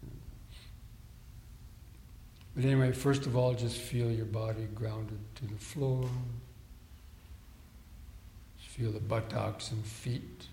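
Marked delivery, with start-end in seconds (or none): 2.6-10.5 guided meditation. Real, not acted. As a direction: talk them into stillness, unhurried and steady. Leads seated breathing meditation.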